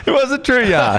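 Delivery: high-pitched, laughing protest; laughing insistence. Real, not acted. Storyteller confirming the yarn to his mate, laughing high. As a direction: insist on it through real laughter. Teller laughing at own joke.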